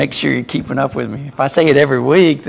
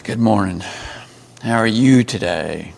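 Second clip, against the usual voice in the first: glum greeting; 4 semitones lower than usual. Shown as a demonstration. Teacher demos a dull, grudging morning hello. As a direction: mumble it like you don't care. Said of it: "I say the same words, but communicate a totally different message".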